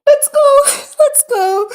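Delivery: high, loud shout of triumph; excited cheer. Real, not acted. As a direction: cheer it out, high-pitched and elated. Celebrating a sudden fix.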